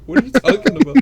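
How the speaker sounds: high, loud, confused laughing question; baffled, laughing. Retold or imitated. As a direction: ask it while cracking up. Friend's baffled reply, laughing.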